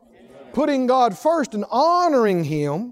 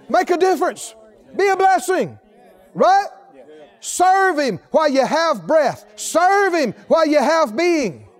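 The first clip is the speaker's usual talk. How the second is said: high, raised exhortation; impassioned pulpit shouting; full-force preaching; 6 semitones higher, 4 dB louder than usual. Real, not acted. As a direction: rouse the congregation with a shouted charge.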